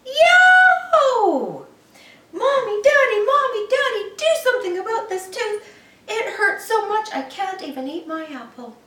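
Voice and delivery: very high, loud howl for both parents; pained yell. A narrator's reading. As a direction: scream for Mommy and Daddy in pain. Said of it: "and yelled".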